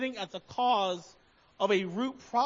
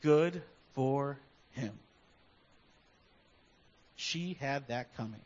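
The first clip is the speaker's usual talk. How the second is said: secret gloating; 9 semitones lower, 7 dB quieter than usual. Retold or imitated. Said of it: "secretly celebrated someone's failure".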